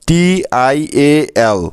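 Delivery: টিআইএএল spelled very slowly letter by letter. Shown as a demonstration. Teacher drilling the spelling for students, very slow letter by letter. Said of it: "মনে রাখবেন".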